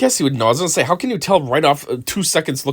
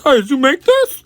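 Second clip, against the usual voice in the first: much higher, louder put-on voice for a silly cop question; mock cop; 13 semitones higher, 5 dB louder than usual. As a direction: parody of a naive detective asking. Parodying cops at pizza place.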